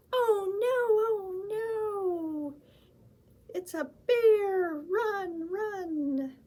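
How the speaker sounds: high, loud squeaky alarm; hammed-up scared little mouse; mock panic. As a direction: cry out in playful fright. Mouse flees the bear.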